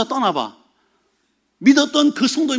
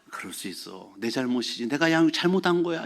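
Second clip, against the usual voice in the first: much softer, humble and calm; soft, forgiving murmur; gentle acceptance; 8 semitones lower, 7 dB quieter than usual. Retold or imitated. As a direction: quietly take the blame. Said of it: "이렇게".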